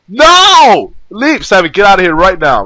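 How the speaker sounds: high, loud commanding shout. Acted. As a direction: order him out at full volume. Clover chases Seven out.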